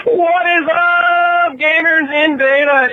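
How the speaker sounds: very high, slow drawn-out intro; catchphrase greeting. Acted. Caller opening his message with a high, stretched hype greeting. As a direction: sing out the greeting like a hype host.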